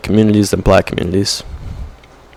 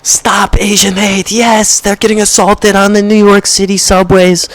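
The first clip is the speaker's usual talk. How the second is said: mocking trend follower; 10 semitones higher than usual. Mocking online activists chanting their slogan in a high put-on voice. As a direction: parody a shrill hashtag crusader. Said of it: "but we just".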